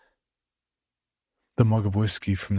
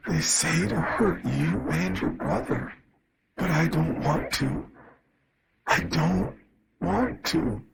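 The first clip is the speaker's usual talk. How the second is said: higher, slow, choked and strained; choking voice; 8 semitones higher, slower than usual. Acted. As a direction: choke up on the words as a broken father. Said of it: "My father's voice choked at these words".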